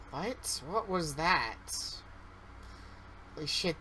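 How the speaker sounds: ordinary delivery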